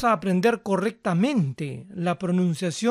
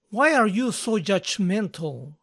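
ordinary talk, nothing marked

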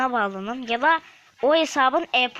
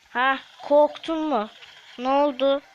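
mocking taunt; 3 semitones higher, 4 dB louder than usual. Real, not acted. Mocking a fleeing opponent.

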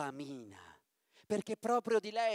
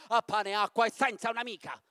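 louder, fast witch threat; witch's threat; 3 semitones higher, 8 dB louder, faster than usual. Acted. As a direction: rattle off the punishment. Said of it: "la strega esclamò". (minaccia della strega, forte e veloce)

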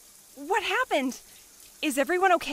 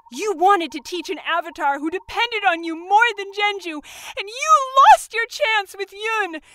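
anger building to a loud outburst; accuse, then erupt on the last words; bitter accusation; 6 dB louder than usual. Acted. Kyoshi accusing the mentor of using her, angry.

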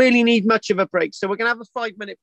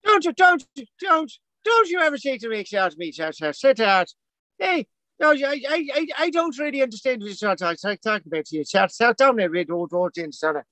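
high-pitched, badly faked Irish scolding; hammed-up Irish accent, stammering in mock outrage; bad put-on Irish accent; 4 semitones higher than usual. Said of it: "one of the worst accents ever".

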